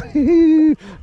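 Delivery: loud high laugh. Real, not acted. Angler laughing at a fast catch, loud laugh. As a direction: burst out laughing.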